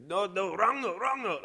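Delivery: high, screaming mock foreign accent; fake Italian-Middle Eastern accent. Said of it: "Italian slash Middle Eastern accent".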